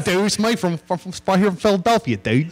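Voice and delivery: put-on playful Philadelphia accent; Philly accent. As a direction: do a jokey Philly guy bragging about his hometown in a Philadelphia accent. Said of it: "That's a Philly accent".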